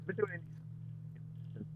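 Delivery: glitchy, dropping-out call audio; garbled audio. Real, not acted. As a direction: voice breaking up over a bad connection. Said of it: "You're, you're very garbled".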